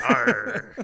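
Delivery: hammed-up pirate noise; pirate growl. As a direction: throw in a jokey pirate arr. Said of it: "it was a pirate thing".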